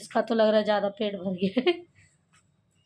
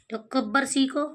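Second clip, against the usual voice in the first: ordinary talk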